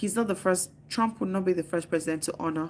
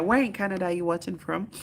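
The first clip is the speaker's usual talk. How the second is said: normal talking voice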